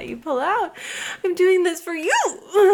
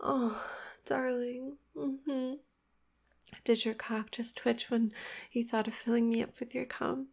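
much softer, hushed and coaxing; soft soothing; 3 semitones lower, 7 dB quieter than usual. Acted. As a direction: soothe him close to the mic. Soothing a frightened partner.

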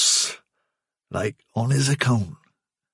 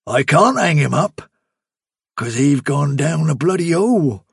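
higher, louder complaint, building; the man complaining to Fiver, rising exasperation; exasperated rustic man; 8 semitones higher, 4 dB louder than usual. Acted. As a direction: grumble in rising frustration.

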